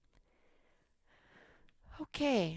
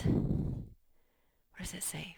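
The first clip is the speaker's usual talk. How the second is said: slow unvoiced prompt to the child; whisper; much slower than usual. Shown as a demonstration. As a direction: whisper the question to coax an answer.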